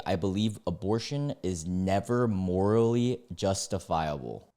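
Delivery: ordinary talk, nothing marked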